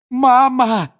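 high, loud cry for mother; desperate cry. A narrator's reading. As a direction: call out to your mother in panic. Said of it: "воскликнул юноша".

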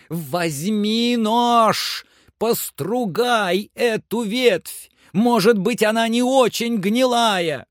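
very high, drawn-out shouted orders; commanding Legrand. A narrator's reading. Master tells climber to test the branch, high shouted orders.

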